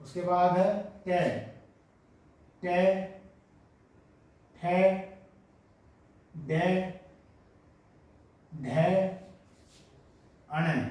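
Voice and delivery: टे, ठे, डे, ढे, अण spaced out; slow dictation. Shown as a demonstration. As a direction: dictate the row slowly. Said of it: "तो पहले ये लिख लेते हैं".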